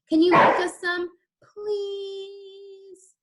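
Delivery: put-on high begging kid; pleading child. A narrator's reading. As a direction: wheedle like a little boy.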